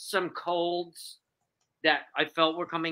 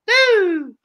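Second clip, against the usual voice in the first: loud high "woo"; excited woo; 12 semitones higher, 10 dB louder than usual. Real, not acted. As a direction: burst out cheering. Celebrating relief.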